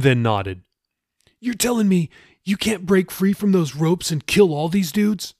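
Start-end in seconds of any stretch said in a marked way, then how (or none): 1.4-5.3 higher-pitched, pressing disbelief; Jeffy, incredulous. Acted. Jeffy presses Raoul to break free, incredulous and higher. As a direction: challenge him in disbelief.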